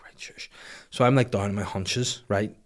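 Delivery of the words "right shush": soft, unvoiced hush; whispered shush. Dad putting the dummy in, whispering. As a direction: hush it without waking anyone.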